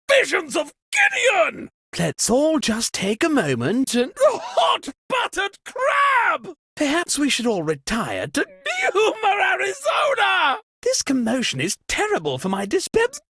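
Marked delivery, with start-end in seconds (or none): 0.1-1.7 high, shocked shout of a made-up oath; startled cry. Acted. Host startled by the blackout, high shocked shout. 4.2-6.5 high, shocked shout of a made-up oath; startled cry. Acted. Host startled by the blackout, high shocked shout. 8.9-10.6 high, shocked shout of a made-up oath; startled cry. Acted. Host startled by the blackout, high shocked shout.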